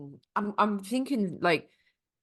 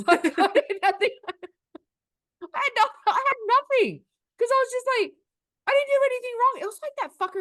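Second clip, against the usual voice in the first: laughing through the words, much higher; real giggles of disbelief while telling it; 5 dB louder than usual. Real, not acted. Laughing while retelling the shock.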